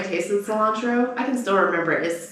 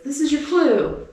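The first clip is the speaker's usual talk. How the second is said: put-on kid quizmaster; 8 semitones higher, slower than usual. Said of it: "he goes".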